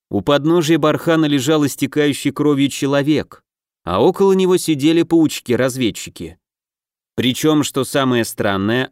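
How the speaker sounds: ordinary delivery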